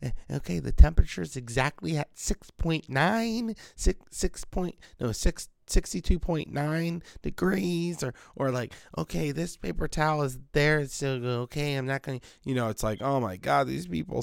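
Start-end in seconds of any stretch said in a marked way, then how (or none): 0.0-8.0 high, softer voice of a picky person; prissy mock precision, pitched up; meticulous fusspot. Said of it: "these people are just so meticulous". 9.0-12.2 softer mock voice of a fussy person; quiet, finicky impression of a stickler; picky fusspot. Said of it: "and picky and".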